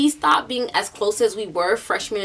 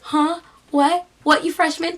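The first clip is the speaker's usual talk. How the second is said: higher-pitched teasing questions; mock taunting; 7 semitones higher than usual. Senior sister teasing a freshman, taunting. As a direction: taunt him, imitating an older sister.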